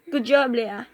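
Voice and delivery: ordinary delivery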